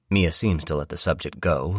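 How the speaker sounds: ordinary delivery, nothing marked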